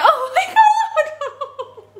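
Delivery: very high, loud, drawn-out squeal; stunned excitement. Real, not acted. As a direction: shriek it slowly through delighted laughter. Amazed reaction to incoming donations.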